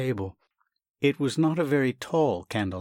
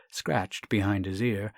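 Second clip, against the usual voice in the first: usual speaking voice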